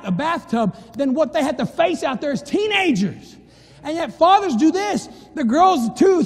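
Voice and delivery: high, loud preacher's build; preaching at full force. Acted. Preacher rousing the fathers in church, at full force.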